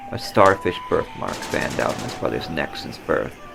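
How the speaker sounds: usual speaking voice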